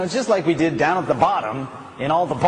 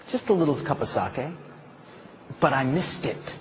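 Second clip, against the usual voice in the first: hushed sincerity; 2 semitones lower, 7 dB quieter than usual. Acted. Quiet intimate turn to audience.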